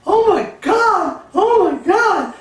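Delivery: put-on high, loud, slow girlish shriek; screaming like a little girl. Agent fleeing the charging bull, shrieking girlishly. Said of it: "screaming like a little girl".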